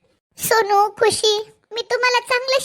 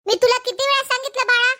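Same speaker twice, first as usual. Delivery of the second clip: ordinary delivery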